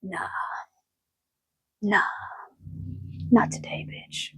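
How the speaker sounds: hushed, voiceless whisper; whispered spirit voice. Retold or imitated. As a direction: breathe it out like a secret spirit. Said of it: "And spirit is saying".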